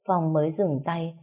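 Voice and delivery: usual speaking voice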